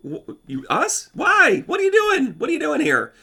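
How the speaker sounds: stunned squeaky "why, what are you doing?"; Zelensky bewildered. Retold or imitated. Zelensky answering Putin's war threat, high and incredulous. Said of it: "Zelensky's kind of saying".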